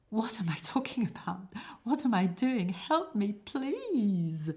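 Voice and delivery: desperate acted cry for help; panicked plea. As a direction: beg the audience for rescue, voice rising. Panicked Jim begs audience for help.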